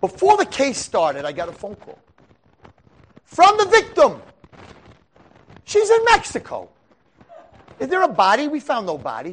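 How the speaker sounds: spring the twist on the jury; dramatic revelation. Retold or imitated. Defense lawyer unveiling the surprise to the jury, loud and high.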